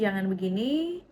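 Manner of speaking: ordinary delivery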